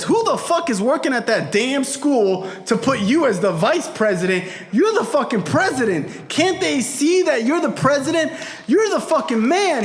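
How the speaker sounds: high, worked-up proud indignation; indignant pride. Retold or imitated. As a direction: rant in outrage on his behalf. Friend voices father's indignant pride.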